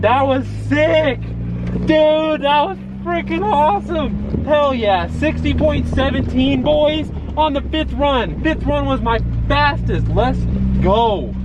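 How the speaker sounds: high, stoked bro shouting; frat bro. Real, not acted. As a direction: still buzzing, drawn-out whoops. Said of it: "I'm like a little kid".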